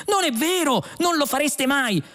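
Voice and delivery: very high-pitched protest; yelled disbelief. A narrator's reading. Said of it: "urlò Trillian".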